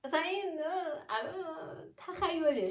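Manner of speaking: giggling high through the words; laughing. Real, not acted. Vlogger laughing at her own silly marriage example. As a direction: laugh it off while calling the idea a fantasy.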